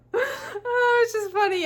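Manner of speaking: high, giggling through the words; laughing so hard her voice squeaks. Real, not acted. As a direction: break up laughing mid-line. Laughing at her own story.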